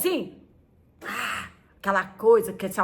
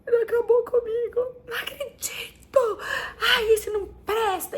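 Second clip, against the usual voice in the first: much higher hammed-up crying of a dumped teen; mock sobbing; 13 semitones higher than usual. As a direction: whimper like a heartbroken girl. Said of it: "quando levava o pé na bunda".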